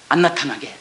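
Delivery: ordinary talk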